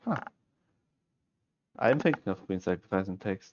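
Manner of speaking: usual speaking voice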